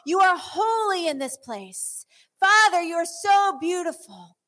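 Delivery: building praise prayer. Acted. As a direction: pray aloud over the room. Leads congregation in prayer.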